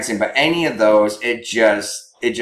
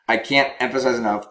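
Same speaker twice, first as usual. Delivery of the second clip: normal talking voice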